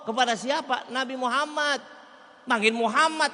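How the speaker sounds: ordinary delivery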